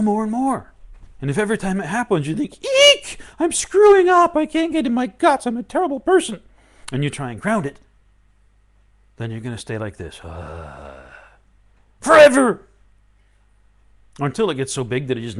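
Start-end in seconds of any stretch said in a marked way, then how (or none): 2.6-6.4 loud, high, squealing panic; panicked self-blame. Retold or imitated. Teacher acting out a student's panic, loud high squeal. 10.2-12.6 mock despair. Mock groan then burst.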